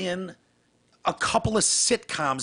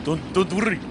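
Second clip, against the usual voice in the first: put-on Arabic accent; 4 semitones higher than usual.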